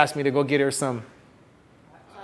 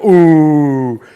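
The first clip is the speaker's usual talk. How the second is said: loud, drawn-out mocking "ooh"; jeering ooh; 8 dB louder than usual. Buddies watching him get turned down, jeering loudly. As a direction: hoot it like teasing buddies.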